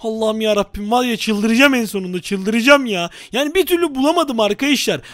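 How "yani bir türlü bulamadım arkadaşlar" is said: ordinary talk, nothing marked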